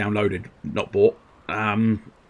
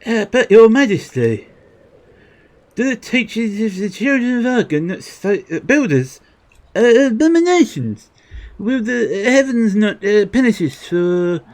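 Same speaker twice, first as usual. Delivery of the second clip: much higher, pleading villager voice; worried subject; 13 semitones higher, 5 dB louder than usual. Acted. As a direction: plead nervously with the king. Voices fearful subject to king.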